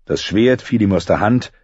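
usual speaking voice

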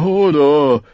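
frightened giant calming down; fading frightened whimper. A narrator's reading. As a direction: let the fright trail off. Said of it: "Hodor jammerte entsetzt".

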